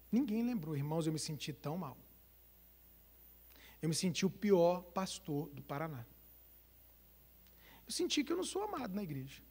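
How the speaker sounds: soft, hurt confession; hurt pastor. Shown as a demonstration. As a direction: open your heart gently, wounded. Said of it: "imagina que eu sou seu pastor". (pastor magoado falando baixinho com a igreja)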